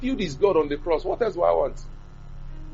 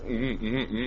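reluctant acknowledgement; 5 semitones lower, 9 dB quieter, slower than usual. Retold or imitated. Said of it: "I said".

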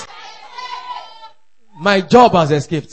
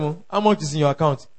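ordinary talk

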